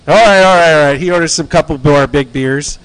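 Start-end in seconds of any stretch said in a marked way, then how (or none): none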